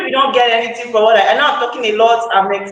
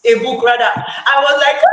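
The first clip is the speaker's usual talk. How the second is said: ordinary talk, nothing marked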